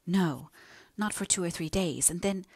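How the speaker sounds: plain reading voice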